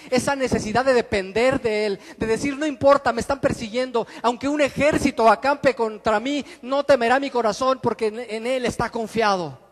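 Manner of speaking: preacher crying out the psalm's trust; full-force preaching. Acted. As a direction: proclaim it to the whole church. Preacher voices a trusting believer.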